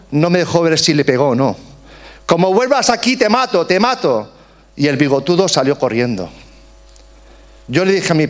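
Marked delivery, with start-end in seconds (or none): 2.3-4.3 retold shout. Father driving off the mustached man, high mock yell. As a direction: throw the threat out high, without real volume. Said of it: "mientras mi padre gritaba".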